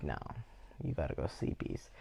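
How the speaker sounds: put-on soft babyish coaxing. Soothing kids to bed.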